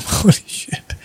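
breathy whispered "Holy shit"; shocked aside whispered to himself. Real, not acted. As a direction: whisper it under your breath. Shocked aside after the laugh.

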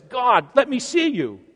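man loudly begging to see God; loud plea. A narrator's reading. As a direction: call out to be seen. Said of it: "he says".

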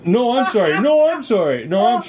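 high, insistent mock apologies; hammed-up over-polite insistence; imitated apology volley. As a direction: act out two people out-apologizing each other. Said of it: "going back and forth like".

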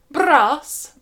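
"Brass" with a tongue-trilled r, high and loud; rolled r. Shown as a demonstration. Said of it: "No, lips again".

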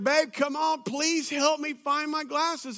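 playful exasperated pleading; retold pleading. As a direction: beg your wife for help, half in fun. Retold pleading with wife for help.

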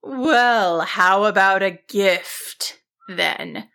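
slow wizard voice with hidden menace; ominous. A narrator's reading. Wizard offering the sheep a gift instead, ominously. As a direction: offer it slowly, like a trap. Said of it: "the wizard responded ominously".

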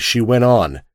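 ordinary delivery

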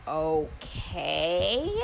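long stretched-out word rising at the end; puzzled okay. Retold or imitated. Woman answers his silence on the phone, puzzled and drawn-out. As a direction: drag it out into a questioning lilt. Awkward silence on the retold call.

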